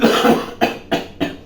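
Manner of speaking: short dry cough. Real, not acted. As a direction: clear the throat with a dry cough. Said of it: "je tousse un peu".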